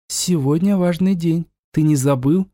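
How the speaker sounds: a light ghostly voice in the mind; lightly voiced phantom woman; whispery telepathic voice. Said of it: "Голос прозвучал в сознании".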